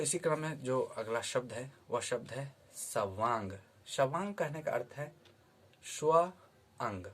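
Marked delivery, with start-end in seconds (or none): 5.8-7.1 स्व said alone, higher and louder, then अंग after a pause; word split apart. Shown as a demonstration. Teacher splitting सवांग into its parts for students. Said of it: "अगर इसको तोड़ते हैं".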